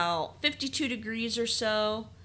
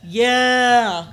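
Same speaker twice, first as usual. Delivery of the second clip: loud, higher drawn-out cheer; excited cheer; 4 semitones higher, 12 dB louder than usual. Real, not acted. Co-host hears they're going to Scotland, excited cheer. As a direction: cheer it out for the trip.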